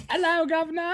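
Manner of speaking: very high, hammed-up British greeting; mock British accent. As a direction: flamboyant fake Cockney hello.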